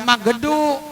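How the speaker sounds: "magedu" with an added e after g; wrong bounced consonant. Shown as a demonstration. Teacher repeating the mistake, "magedu" with bounced g. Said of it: "Jangan magedu".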